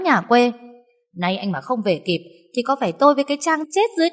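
scornful tirade rising to a high wail; railing mother-in-law. A narrator's reading. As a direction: berate the daughter-in-law, ending in a lament. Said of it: "chỉ tay vào mặt hai mẹ con cô mà xỉ vả". (mẹ chồng chửi rủa)

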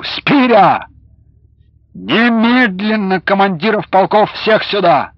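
loud, high, joyful call; cheerful shout. A narrator's reading. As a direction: shout out in delight. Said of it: "весело крикнул".

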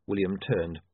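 ordinary delivery

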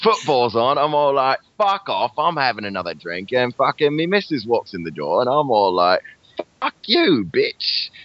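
mock-indignant Cockney pub story; Cockney accent. Friend acts out a pub row, Cockney accent. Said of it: "What's good to say in a Cockney accent?".

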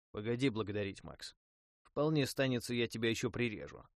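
usual speaking voice

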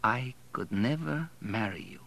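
soft, dazed echo; stunned repetition. A narrator's reading. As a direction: repeat it quietly, taking in the rejection. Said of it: "he repeated".

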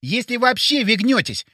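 high-pitched indignant retort; indignant exclamation. A narrator's reading. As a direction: exclaim it in protest, pitched well up. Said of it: "возмущённо воскликнул Боря".

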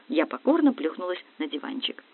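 ordinary delivery, nothing marked

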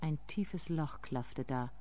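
soft, intimate narrating voice; softly spoken inner monologue; hushed story narration. A narrator's reading.

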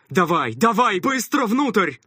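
loud, high urgent command; shouting. A narrator's reading. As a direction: shout them inside. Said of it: "крикнул".

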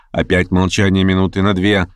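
plain reading voice

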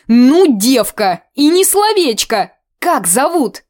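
high, loud folksy exclamation; rustic auntie, astonished; amazed old aunt. Acted. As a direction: tease the girl in disbelief.

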